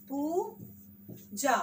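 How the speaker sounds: पूजा with the long oo stretched very slowly; very slow stretched word. Shown as a demonstration. Teacher slowly stretching पूजा for pupils. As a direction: drag out पूजा syllable by syllable. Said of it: "खींच के".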